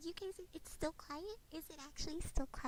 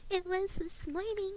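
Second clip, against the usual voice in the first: ordinary delivery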